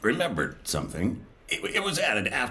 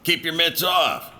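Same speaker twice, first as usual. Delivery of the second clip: raised, emphatic scolding; protective warning; 5 semitones higher, slower than usual. Acted. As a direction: warn them off sharply, slow then rising. Warns someone off Sammy's pastry.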